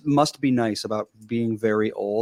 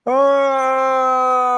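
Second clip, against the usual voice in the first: loud, high mock yawn; imitating a big yawn; 13 semitones higher, 5 dB louder than usual. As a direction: act out a huge yawn, loudly. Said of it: "He literally goes".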